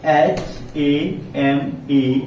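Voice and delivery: each letter of S H A M E spaced out slowly; teacher dictating letters of a word one by one; slow letter-by-letter spelling. Shown as a demonstration. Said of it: "जब आप पढ़ेंगे".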